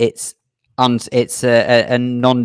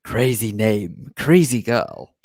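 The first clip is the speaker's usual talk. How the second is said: slow, lightly lowered spooky voice; low scary voice; much slower than usual. Shown as a demonstration. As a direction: attempt a deep, creepy drawl. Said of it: "I can't get that low, but I'd say".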